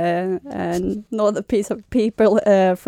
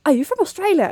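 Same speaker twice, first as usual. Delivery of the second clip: put-on Australian accent; 8 semitones higher than usual. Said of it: "I'll speak Australian".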